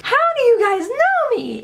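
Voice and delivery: high-pitched, disbelieving question; astonished. Retold or imitated. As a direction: squeak out your amazement. Stunned at being recognized.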